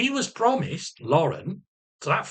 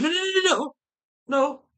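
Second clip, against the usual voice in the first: talking over him; 13 semitones higher than usual. Retold or imitated. Cutting doctor off.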